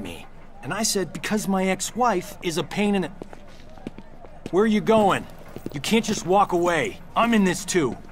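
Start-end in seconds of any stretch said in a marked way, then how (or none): 4.5-8.0 loud, high-pitched shout after someone; raised voice, demanding to be included; indignant protest. Acted. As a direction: call out angrily to stop them leaving. Protests as the other walks off.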